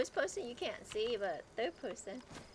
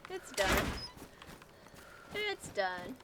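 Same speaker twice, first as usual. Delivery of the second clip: breathless after the fight; panting between words; out of breath; 4 semitones lower, slower than usual. Real, not acted. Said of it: "We're out of breath".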